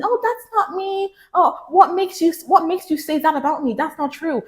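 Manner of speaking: put-on high, pleading protest in a girlish voice; defensive girl protesting. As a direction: act out a flustered woman denying it. Girl arguing with a man.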